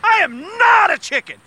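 high, loud, puffed-up boast; defiant bravado. Acted. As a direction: puff up and take the dare loudly. Cop goaded by reverse psychology.

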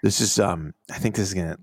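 mock laid-back bro, low and quiet; a hint of a stoned bro. Bro voicing Scott Disick's friend.

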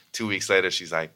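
ordinary talk

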